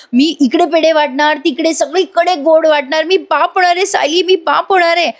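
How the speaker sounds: high-pitched, breathless-with-joy rush of news; mock excited shouting. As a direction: crow with delight, pitched well up. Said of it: "मोठ्या मोठ्याने ओरडायला लागतो".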